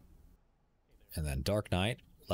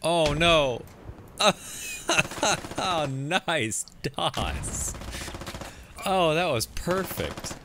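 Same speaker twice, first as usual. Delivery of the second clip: higher, louder excited cheer with laughter; 7 semitones higher, 4 dB louder, much slower than usual. Real, not acted. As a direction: react with pure delight. Caster reacts to perfect grenade.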